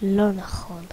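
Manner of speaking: unvoiced whisper of disbelief. Real, not acted. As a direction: breathe it out as a whisper.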